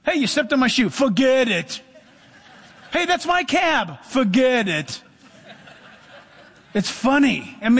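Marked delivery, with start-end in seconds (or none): 0.0-1.8 higher-pitched New Yorker street complaint; mock an affronted New Yorker; hammed-up New York accent. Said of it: "You ever been to New York?". 2.9-5.0 high New Yorker protest, slow sign-off; mock a New Yorker losing a cab; hammed-up New York accent, drawn-out ending. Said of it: "You ever been to New York?".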